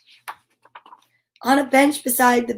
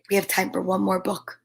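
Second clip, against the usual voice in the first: plain reading voice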